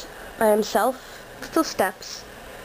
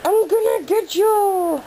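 playful monster voice; 7 semitones higher, 3 dB louder, slower than usual. Pretend. Teasing the puppy, drawn out.

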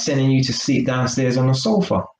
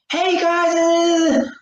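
much higher, hammed-up goofy shout; silly boyfriend voice; much slower than usual. Mocking a childish boyfriend, hammed-up high goofy shout. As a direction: play the fool to mock him.

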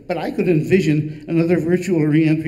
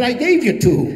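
indignant, higher and louder; a laughing, indignant shopkeeper; 7 semitones higher, 5 dB louder than usual. Retold or imitated. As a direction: insist hotly on the point. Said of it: "He was indignant".

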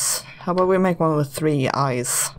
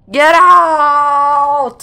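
full ghostly scream, much higher and louder; furious shout; 9 semitones higher, 9 dB louder than usual. Acted. Ghost banishes the girl, screaming in fury. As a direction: blast the girl out of the room. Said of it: "Sorry, neighbors".

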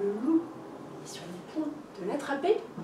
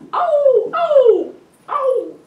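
high, loud frantic howling; crazy wolf howls; 15 dB louder, much slower than usual. A narrator's reading. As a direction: howl like a madman. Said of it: "le loup hurle comme un zinzin". (hurlements de loup fous, aigus et forts)

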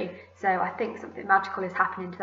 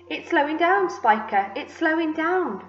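high, exclaiming repetition; excited insistence; 7 semitones higher than usual. A narrator's reading. Aunt telling sister about the peach, excited insistence. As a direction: insist on it, voice raised.

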